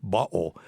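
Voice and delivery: "bottle" with a glottal stop for the tt; northern UK "bottle", said bo'le. Shown as a demonstration. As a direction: drop the t of "bottle" for a throat catch. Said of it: "U K accent, you say bottle".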